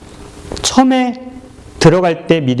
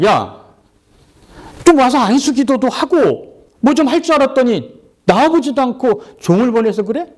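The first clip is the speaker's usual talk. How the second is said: high, indignant complaint; the insulted general fumes at the prophet; angry general; 9 semitones higher than usual. Acted. Angry general ranting about Elisha's servant. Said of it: "나만 장군은 화가 났어요".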